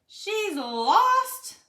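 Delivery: high, loud panicked cry; alarmed cry. Acted. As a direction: blurt it out in alarm. Arthur finds dog missing.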